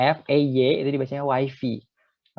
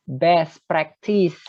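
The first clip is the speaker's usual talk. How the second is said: slow model reading; 2 semitones higher, much slower than usual. Shown as a demonstration. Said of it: "teacher models the phrase".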